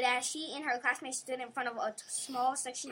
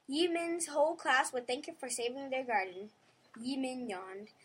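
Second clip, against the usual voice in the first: ordinary delivery, nothing marked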